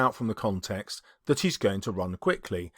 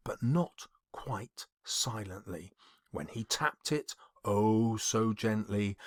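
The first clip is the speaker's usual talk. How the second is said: hushed suspense; 3 semitones lower, 9 dB quieter, slower than usual. A narrator's reading. Teacher reading a bomb scene to build tension, hushed. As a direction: drop to a near whisper, slow.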